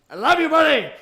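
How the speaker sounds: high, loud doting; tender to dog. Real, not acted. Streamer telling his dog he loves him, high doting voice. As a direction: dote on your buddy.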